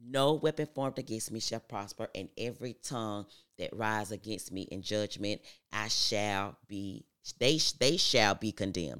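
declaration building to a loud finish; emphatic affirmation recital. Acted. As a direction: proclaim it like scripture, growing forceful.

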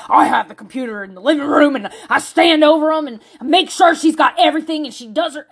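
hammed-up intense dad impression. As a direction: imitate a dad who is far too intense. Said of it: "His voice was like that".

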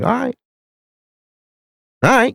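high-pitched all right with rising end; mock grudging acceptance. Son answering dad's apology, mock grudging acceptance.

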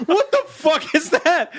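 high, slow, laughing disbelief; mock startled question while laughing; baffled. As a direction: ask it half laughing, can't believe it. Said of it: "And we're like".

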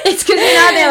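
laughing. Real, not acted. Both laughing about sweets.

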